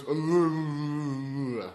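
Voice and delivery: long, droning mumble mocking an accent; imitated thick-accent mumble. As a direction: drone out wordless gibberish, mockingly. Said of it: "your accent is very, very thick".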